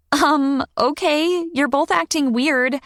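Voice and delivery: put-on high, puzzled child's voice; a little girl sensing something off; young girl voice. Child voice in story.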